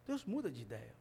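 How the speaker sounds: Deus muda de ideia. said low and soft; quiet answer. Shown as a demonstration. Preacher answering his own question, low and soft. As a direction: answer it softly.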